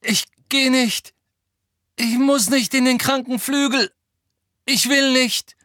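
high, loud broken-off outburst; choppy, desperate protest. A narrator's reading. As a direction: force out the words in loud, high bursts. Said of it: "Abgehackte Worte kamen aus Harrys Mund".

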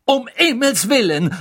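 high, loud exclamation; outraged outburst; irate. A narrator's reading. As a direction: exclaim angrily at high pitch. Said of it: "sagte Madame Pomfrey erzürnt".

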